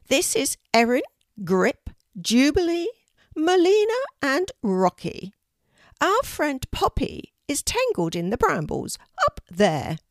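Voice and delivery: high, loud bird voice; an urgent talking raven; unusual raven voice. A narrator's reading. Raven introduces friends.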